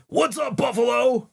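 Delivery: high, loud mock frontman hype; hyped-up stage announcer voice; stage shout-out. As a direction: shout it like a band greeting the crowd. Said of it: "Band in Niagara Falls going".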